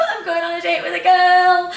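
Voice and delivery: high, loud sing-song glee; excited. Retold or imitated. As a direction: sing it out with giddy joy. Giddy after faking sick call.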